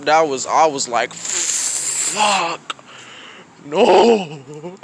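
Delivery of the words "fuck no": louder, rising dread on no; dismayed outburst. Retold or imitated. Student hearing the teacher will call his mom, dismayed. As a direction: blurt it out as your stomach drops.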